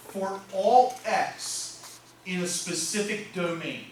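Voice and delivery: each word drawn out slowly for note-taking; slow dictation. Shown as a demonstration. Teacher to students copying the notation, slow careful dictation.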